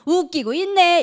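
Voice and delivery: loud sneering retort; mock partner sneering back; scoffing.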